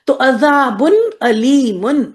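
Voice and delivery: عذاب علیم slow, علیم with hamza, not ain; correct hamza. Shown as a demonstration. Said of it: "اس کو علیم نہیں کریں گی". (حمزہ سے علیم، عین سے نہیں)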